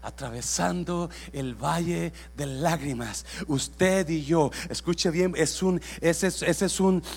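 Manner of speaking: shouted, high, fervent sermon cry; full-force preaching. Acted. Pastor shouting blessing over the congregation, full-force preaching. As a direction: preach it out to the whole church.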